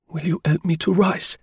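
a hurt woman forcing some firmness; trying to sound firm. A narrator's reading. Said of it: "in a voice that tried to be firm".

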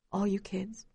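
barely any energy left; tired voice. A narrator's reading. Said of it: "Ma tells us in a tired voice".

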